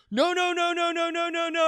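high, rapid-fire mock denial; panicked protest. The scammer protesting when confronted, mock panic. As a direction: imitate a flustered man cutting in frantically.

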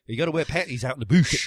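mock Australian accent. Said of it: "is that Australian".

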